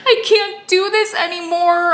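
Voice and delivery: high, slow tearful wail, imitated; hammed-up sobbing, drawn out; mock sobbing. Pregnant wife breaking down to husband, mock sobbing. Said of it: "I just started sobbing".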